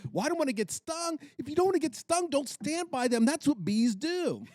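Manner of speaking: high, exasperated back-and-forth; retold argument. Arguing with her mother about getting stung, high and exasperated. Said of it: "I said".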